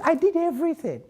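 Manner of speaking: "I did everything" thrown up high in exasperation; exasperated cry. Retold or imitated. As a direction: push "did" up high, fed up. Climax of list of efforts.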